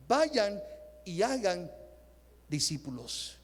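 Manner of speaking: "Vayan", "hagan", "discípulos" set apart by pauses, falling low on "discípulos"; measured quoted command. Retold or imitated. Preacher quotes Jesus sending out the disciples, measured command.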